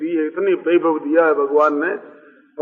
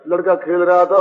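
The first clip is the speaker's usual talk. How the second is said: normal talking voice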